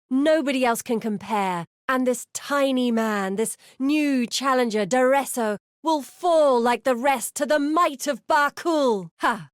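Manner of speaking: a champion bragging over the roar of the crowd; boastful gladiator. Acted. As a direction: arrogant arena braggart.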